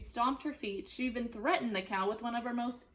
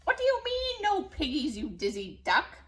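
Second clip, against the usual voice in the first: loud, high outraged witch; indignant witch; 6 semitones higher, 5 dB louder than usual. Acted. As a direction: snap at the duck. Witch berates duck.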